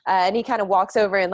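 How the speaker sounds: ordinary delivery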